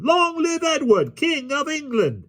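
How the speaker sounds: very high, drawn-out cheer; thunderous crowd response. A narrator's reading. Motley crew roars back to the ruffler, very high slow cheer. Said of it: "The response came with such a thundergust".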